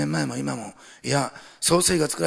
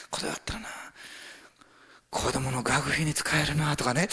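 soft sigh of reluctance, dragging the words out; grudging giver; 4 dB quieter than usual. Acted. Parent thinking of school fees, grudging regret. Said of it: "惜しみながらする人なんです".

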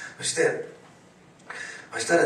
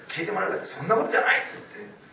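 higher-pitched retold scolding; forceful rebuke; 7 semitones higher, 4 dB louder than usual. Fasting expert correcting proud believers, retold forceful rebuke. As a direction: mildly imitate a formidable preacher setting people straight. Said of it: "ああいう人はとなく迫力ありますね".

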